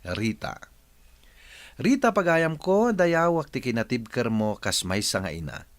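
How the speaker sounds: ordinary talk, nothing marked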